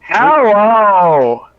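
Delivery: loud, high calling hello. Real, not acted. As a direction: call it out loudly. Testing the call loudly.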